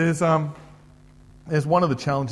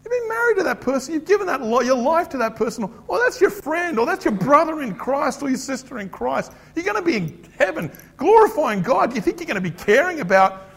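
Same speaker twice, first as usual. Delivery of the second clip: high-pitched, loud telling-off; indignant peacemaker; 11 semitones higher, 6 dB louder than usual. Acted. Peacemaker challenging someone griping about a spouse, indignant scolding. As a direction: confront them in a raised, incredulous voice. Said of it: "The peacemaker will stand up".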